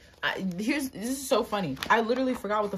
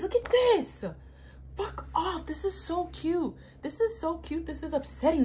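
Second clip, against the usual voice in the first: much higher, excited gushing; 11 semitones higher than usual. Real, not acted. Fan gushes to viewers over the album photos, excited. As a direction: gush like a starstruck fan.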